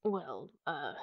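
usual speaking voice

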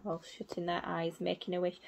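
ordinary delivery

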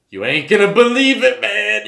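hyped-up come-on, building suspense; high, loud teasing build-up; excited tease. Retold or imitated. Teasing [name] with the news.

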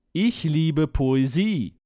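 ordinary talk

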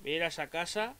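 ordinary talk

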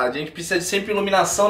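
ordinary delivery

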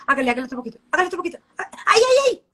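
building from a strained plea to high, loud yelps of pain; imitating a yoga client pushed past the point of pain; mock stretching pain. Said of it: "Y tú dices".

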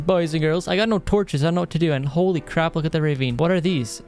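ordinary delivery

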